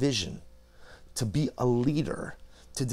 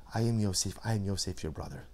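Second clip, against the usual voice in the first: softer, dropping to near hush; hushed, tender reveal; 4 semitones lower, 7 dB quieter than usual. Acted. Yosef tenderly revealing himself to his brothers, hushed. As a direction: say it gently, as a brother found again.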